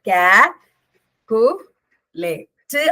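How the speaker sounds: "കാ-, കു-, ലേ-, റ്റ്" chopped into syllables with pauses. Shown as a demonstration. Teacher showing students there are three syllables, "കാ-, കു-, ലേ-, റ്റ്" broken apart. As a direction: separate each beat, tacking a short "റ്റ്" onto the end. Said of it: "അപ്പൊ മൂന്നെണ്ണാണ് ട്ടോ".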